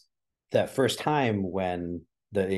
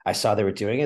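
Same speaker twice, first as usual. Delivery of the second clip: ordinary talk, nothing marked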